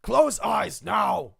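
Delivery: slow, loud orc shout; commanding orc. Acted. Orc orders his prisoner Six, slow bellowed command. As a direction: order it forcefully, word by word. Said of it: "he looks back to Six".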